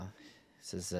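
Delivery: ordinary talk, nothing marked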